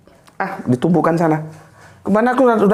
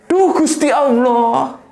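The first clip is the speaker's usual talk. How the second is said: slow, higher drawn-out cry of surprise; startled mother; 5 semitones higher, much slower than usual. Retold or imitated. Mother surprised by her son's unannounced return, drawn-out cry. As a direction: stretch it out as a stunned exclamation. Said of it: "Dia bilang".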